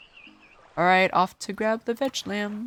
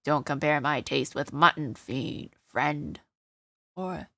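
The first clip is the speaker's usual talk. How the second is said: demonic voice; 6 semitones lower than usual. Shown as a demonstration. Voicing the offended fruit, a lower demonic voice. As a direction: lower demonic voice, lightly done. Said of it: "no, I need a demonic voice".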